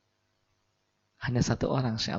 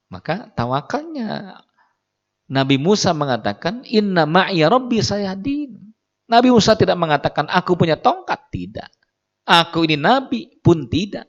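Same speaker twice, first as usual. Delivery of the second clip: fiery preaching; 9 semitones higher, 9 dB louder than usual. Acted. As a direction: preach it out with full force. Preaching at full force. (berkhotbah lantang dan berapi-api)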